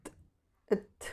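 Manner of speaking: ത് then ഇത്ത്, soft th sound; teacher modelling the th sound. Shown as a demonstration. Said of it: "ടി plus എച്ച്".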